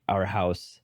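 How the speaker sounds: usual speaking voice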